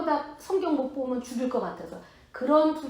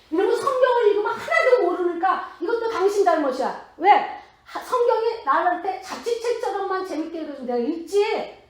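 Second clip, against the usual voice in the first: higher and louder, half-laughing complaint; laughing indignant outburst; 6 semitones higher, 5 dB louder than usual. Retold or imitated. Retelling how she argued with God, laughing indignant shout.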